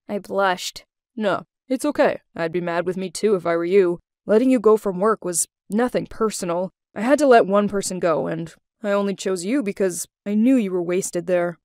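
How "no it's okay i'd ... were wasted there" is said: lowered voice of the former boss; low-pitched man explaining himself; Adrian. A narrator's reading. Ex-boss excusing the firing to former employee, low male character voice.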